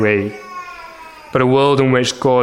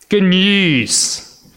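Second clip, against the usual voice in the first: proclaiming good news; joyful herald's cry; 6 semitones higher than usual. Acted. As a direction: call it out like a herald. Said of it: "the voice says".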